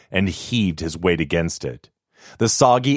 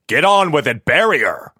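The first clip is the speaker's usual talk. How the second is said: loud, higher barked command; angry order; 8 semitones higher, 5 dB louder than usual. Acted. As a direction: snap at the diggers to get on with it. Master orders burial angrily.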